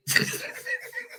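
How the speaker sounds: silent squeaky wheeze of laughter; high wheezing laugh. Real, not acted. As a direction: break into a high breathless laugh. Laughs at the headline.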